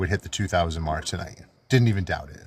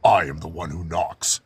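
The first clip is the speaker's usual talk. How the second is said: Heisenberg; 2 semitones lower than usual. Retold or imitated. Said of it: "do my best, uh, Heisenberg impression".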